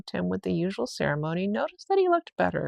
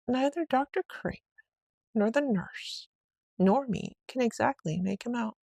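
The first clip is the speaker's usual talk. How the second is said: lightly voiced housekeeper, higher, fumbling for words; housekeeper explaining; 5 semitones higher, 3 dB quieter than usual. A narrator's reading. Housekeeper struggling to explain the boy, lightly voiced. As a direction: try to explain, a bit flustered. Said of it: "she tried to explain".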